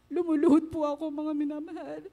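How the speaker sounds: pleading testimony on the verge of crying; tearful prayer. Real, not acted. Tearful testimony of praying in hospital.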